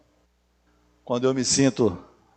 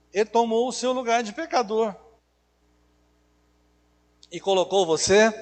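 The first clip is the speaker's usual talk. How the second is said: ordinary talk, nothing marked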